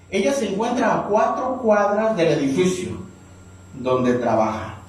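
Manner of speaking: normal talking voice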